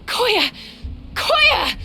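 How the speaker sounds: high urgent cries of a name; frightened shouts for a fallen friend; alarmed calling. Acted. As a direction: call out to her in alarm. Calling out to fainted Koya.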